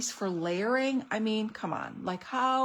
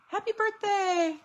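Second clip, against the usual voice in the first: sing-song read; 11 semitones higher, 3 dB louder than usual. Acted. Reading a cute stamp sentiment.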